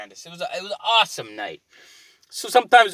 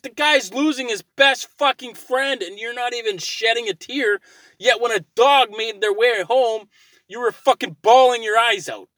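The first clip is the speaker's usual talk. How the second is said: much higher, heated complaint; exasperated rant; 9 semitones higher, 3 dB louder than usual. Retold or imitated. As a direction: vent at her for crying over a dog. Retold rant at wife.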